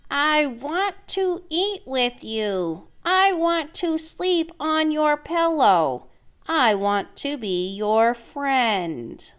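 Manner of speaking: slow frog voice, lightly voiced. Frog asking the princess for friendship, slow and gentle. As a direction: plead gently and slowly as the frog. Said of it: "The frog replied".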